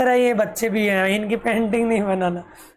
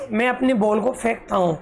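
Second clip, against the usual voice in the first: ordinary talk, nothing marked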